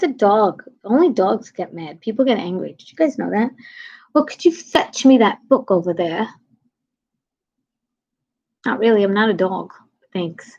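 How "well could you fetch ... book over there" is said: mock-British polite request; imitated Brit asking a favour; British accent. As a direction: put on a British accent, leaning on "fetch". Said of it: "I noticed that British have sayings".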